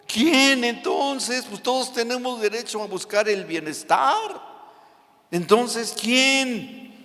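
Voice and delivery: anxious disciple. Acted. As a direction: wring your hands over who can be saved. Disciples' worried question acted out.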